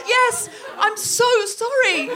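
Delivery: high, drawn-out, hammed-up wail; mock apology. Former classmate confessing she was chosen, exaggerated apology. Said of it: "she said".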